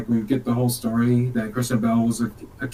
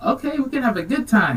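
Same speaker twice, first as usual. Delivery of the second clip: much higher and fast, teasing waitress voice; flirty waitress; 10 semitones higher, much faster than usual. Retold or imitated. Waitress cooing at the customer, high and fast. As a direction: chirp it up high and quick like a come-on. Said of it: "She's like".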